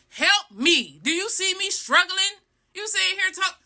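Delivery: high-pitched exasperated pleading; acting out fed-up scolding. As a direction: pitch climbing as indignation builds. Acted exasperation at catcalling man.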